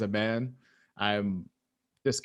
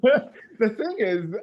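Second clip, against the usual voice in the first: mock pompous opening; 11 semitones higher, 7 dB louder, much slower than usual.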